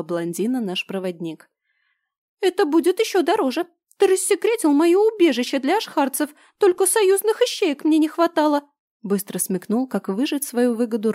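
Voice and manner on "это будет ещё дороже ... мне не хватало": high squeaky shouting trader; shrill little creature yelling. Acted. Blau's squeaky voice again.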